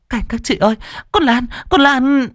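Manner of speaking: high-pitched panicked cry for help; horrified. A narrator's reading. Frightened girl calls the neighbours, horrified high wail. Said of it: "Hằng kinh hãi".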